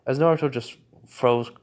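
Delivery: usual speaking voice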